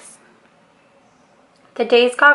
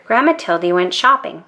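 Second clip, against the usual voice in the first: usual speaking voice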